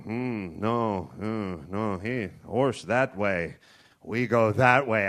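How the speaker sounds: grave, unhurried pointing of the way; stoic tracker. Pretend. Indian tracker calmly telling the cowboy which way. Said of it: "I'd make a pretty good Indian".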